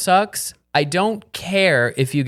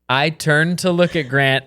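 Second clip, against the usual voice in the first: ordinary delivery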